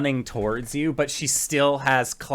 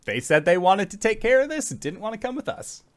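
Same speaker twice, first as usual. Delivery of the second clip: lightly voiced climbing toy, higher and quick; Yarn Spinner; 7 semitones higher, much faster than usual. Yarn Spinner climbing away from the fight, higher hurried voice. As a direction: pitch it up a little and rattle it off. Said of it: "Yarn Spinner just, like, continues up the wall".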